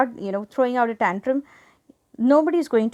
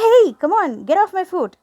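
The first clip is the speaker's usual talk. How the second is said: louder, higher rude protest; mock yell; 6 semitones higher, 6 dB louder than usual. Shown as a demonstration. Teacher shows a stranger the wrong way, loud mock yell. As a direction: snap at them for stepping on your foot. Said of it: "yelling at that person".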